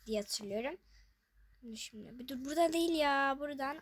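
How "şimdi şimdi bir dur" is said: soft whispered self-talk. Real, not acted. Gamer telling herself to stop, whisper. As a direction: hush yourself to focus.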